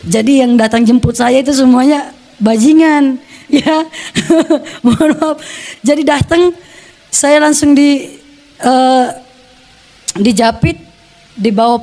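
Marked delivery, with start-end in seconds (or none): none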